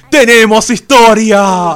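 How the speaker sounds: loud, slow triumphant cry; excited shout. Acted. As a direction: announce it like a big victory. Mock celebration that the game has a story.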